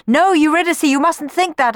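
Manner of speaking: high, loud, urgent protest; anguished cry. A narrator's reading. Orpheus to fading Eurydice, urgent high cry. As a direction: cry out to stop her leaving. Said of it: "cried Orpheus".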